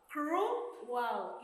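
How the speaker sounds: ordinary talk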